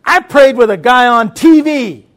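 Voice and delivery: loud, high, emphatic retort, stretching the end; finger-jabbing shouted declaration; imitated indignant grandma. Grandma with crutch defending her faith, indignant shout. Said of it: "put her finger in my face".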